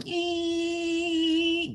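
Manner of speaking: high, sustained electronic whine; car noise imitation. Describing the car's key turning, high whining noise. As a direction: squeal out a steady high-pitched "eee". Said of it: "and you hear go".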